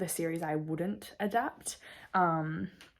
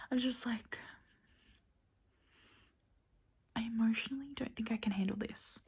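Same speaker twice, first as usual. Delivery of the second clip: hushed, overwhelmed whisper; 2 semitones higher, 5 dB quieter than usual. Real, not acted. To viewers about the tense book, whispered. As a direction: whisper it like you can't bear it.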